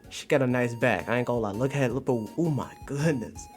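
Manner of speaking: ordinary talk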